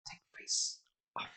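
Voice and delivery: unvoiced, strangled whisper; strained whisper. A narrator's reading. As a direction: choke it out breathless. Tearing at her face.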